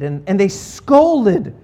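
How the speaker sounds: scolded drawn out slow and weighty; stress on scolded. Acted. Preacher telling the congregation they scolded, stressing scolded. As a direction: lean on scolded, slowly, let it land. Stresses harsh verb slowly.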